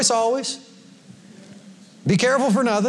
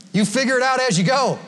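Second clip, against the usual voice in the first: usual speaking voice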